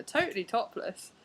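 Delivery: usual speaking voice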